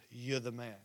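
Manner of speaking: low, very soft quoted accusation; hushed confrontation. Retold or imitated. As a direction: drop right down for a quiet, grave quote. Nathan confronts the king, gravely.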